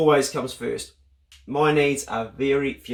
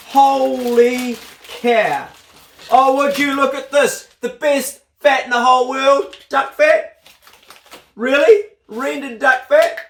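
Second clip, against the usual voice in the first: higher and louder, drawn-out holy cow; thrilled; 7 semitones higher, 4 dB louder, slower than usual. Real, not acted. Vlogger discovering rendered duck fat in the gift, thrilled. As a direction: gush over the duck fat.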